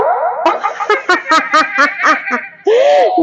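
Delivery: shouted laugh through the mic effect. Real, not acted.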